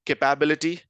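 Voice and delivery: kuh-PAB-ility, stress on PAB; variant pronunciation. Shown as a demonstration. Teacher offering quiz option, "capability" mispronounced with stressed PAB. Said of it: "different versions of the pronunciation of this word".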